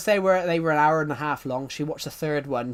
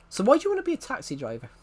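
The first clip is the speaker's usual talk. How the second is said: Texan accent, higher and fast; quick, pitched-up Texan question; 9 semitones higher, faster than usual. Shown as a demonstration. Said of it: "I was doing a Texan accent".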